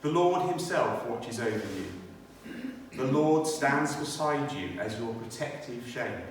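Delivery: ordinary talk, nothing marked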